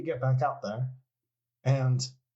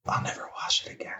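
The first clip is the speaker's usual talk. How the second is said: whispering; faster than usual. Acted. Starstruck fan whispers reply.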